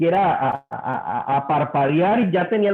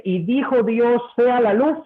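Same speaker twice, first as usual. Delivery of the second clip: proclaiming scripture; 7 semitones higher than usual. Acted. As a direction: proclaim it like creation itself. Cita del Génesis. (proclamación solemne, más alta y fuerte)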